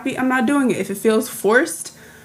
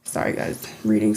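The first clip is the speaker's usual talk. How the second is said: whispered aside; whispering; 12 semitones lower, 6 dB quieter than usual. Real, not acted. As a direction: whisper an apology. Aside while reading something.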